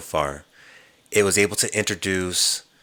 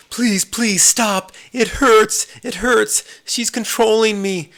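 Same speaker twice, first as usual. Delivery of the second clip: high, louder, slow mock pleading in pain; 6 dB louder, slower than usual. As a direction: beg like it physically hurts, voice much higher. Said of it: "And he goes".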